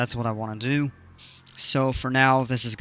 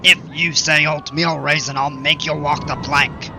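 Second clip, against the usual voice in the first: loud, threatening cartoon pirate; 3 semitones higher, 5 dB louder than usual. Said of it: "Now I'm getting real stupid".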